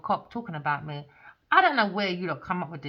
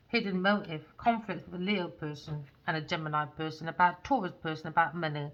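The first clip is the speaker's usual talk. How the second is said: ordinary delivery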